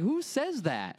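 high, disbelieving question; incredulous. Retold or imitated. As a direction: ask it in total disbelief. Incredulous retort in story.

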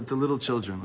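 ordinary delivery

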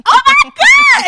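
high, loud shout of dismay; exasperated shout. Retold or imitated. Daughter brushed off by dad, shouting high in dismay. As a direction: cry out, wounded and over it.

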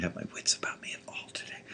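whispered aside to self; whispering. Real, not acted. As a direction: whisper it to yourself. Muttered self-remark.

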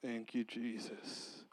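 very soft, slow murmured prayer; Jesus thanked under the breath; hushed thanks. Real, not acted.

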